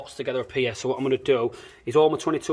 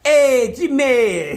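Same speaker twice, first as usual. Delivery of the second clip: put-on Spanish accent; 9 semitones higher, 5 dB louder, much slower than usual. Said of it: "You sound like a Dom Ingram".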